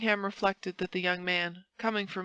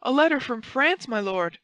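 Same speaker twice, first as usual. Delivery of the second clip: high, loud announcement; crying out; 5 semitones higher, 6 dB louder than usual. A narrator's reading. Patrick enters with a letter for his master, crying out. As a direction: call out urgent news, pitched up.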